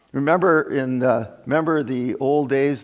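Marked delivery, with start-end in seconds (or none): none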